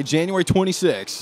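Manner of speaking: usual speaking voice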